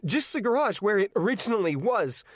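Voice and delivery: pressing, composure slipping; teen pleading, calm starting to crack. A narrator's reading. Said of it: "his calm starting to crack".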